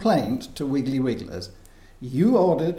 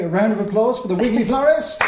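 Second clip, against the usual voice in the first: fast, higher, louder announcing; excited host announcement; 6 semitones higher, 4 dB louder, faster than usual. Real, not acted. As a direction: hype up the applause.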